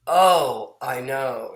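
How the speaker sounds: loud, commiserating neighbour; hammed-up sympathy, big on the "Oh"; acted emphatic agreement. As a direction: burst in loudly to agree.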